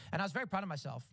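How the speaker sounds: usual speaking voice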